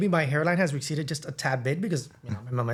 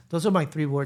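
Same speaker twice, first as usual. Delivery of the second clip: normal talking voice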